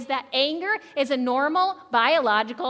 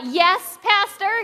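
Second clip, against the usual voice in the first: congregation reply; 5 semitones higher, 4 dB louder, slower than usual. Shown as a demonstration. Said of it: "give me a little".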